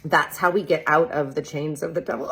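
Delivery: ordinary talk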